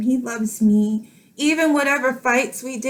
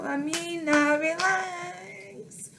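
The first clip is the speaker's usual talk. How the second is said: soft, teary self-soothing, much quieter and higher; calm yourself down through tears; getting emotional; 7 semitones higher, 9 dB quieter, much slower than usual. Real, not acted. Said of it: "And I'm getting emotional".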